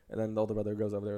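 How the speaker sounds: usual speaking voice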